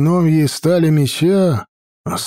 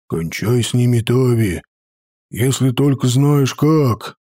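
dull muffled dwarf; 2 semitones lower than usual. A narrator's reading. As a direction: say it flat and heavy. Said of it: "глухо сказал Сидри".

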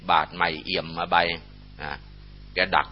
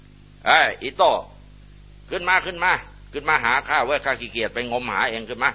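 summoning call, bossy and raised; 5 semitones higher than usual. Acted. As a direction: shout it out over the water, commanding. Summons the knife from the river.

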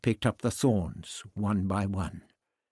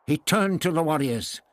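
ordinary delivery, nothing marked

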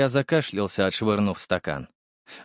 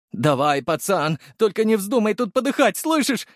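rising, high-pitched plea; frantic urging; 10 semitones higher than usual. A narrator's reading. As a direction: urge a dying lad to hold on. Guard begs patient not to die. (встревоженная мольба, всё выше)

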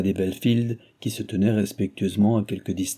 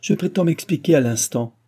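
raised, alarmed demand; frightened insistence; 5 semitones higher, 5 dB louder than usual. A narrator's reading. Cécile demands to get out, frightened insistence. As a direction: insist urgently, voice high. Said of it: "s'écria Cécile effrayée".

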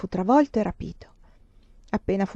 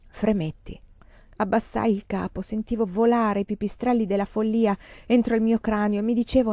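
usual speaking voice

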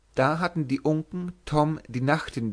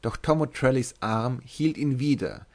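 ordinary delivery

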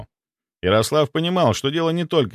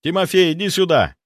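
higher-pitched call through the door; 8 semitones higher, 3 dB louder than usual. A narrator's reading. Master to butler at the door, calling out. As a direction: summon the butler with a restrained shout. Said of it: "повернулся к двери и выкрикнул".

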